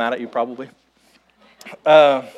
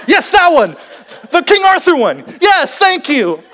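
higher, louder, laughing with relief; overjoyed; 9 semitones higher, 4 dB louder than usual. Retold or imitated. Mother relieved her family finished her sentence, overjoyed and laughing. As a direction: gush it like she's just been rescued. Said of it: "she was so overjoyed".

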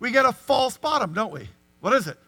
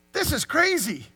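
mock exasperation; 6 semitones higher, 3 dB louder than usual. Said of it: "what Abraham was not doing".

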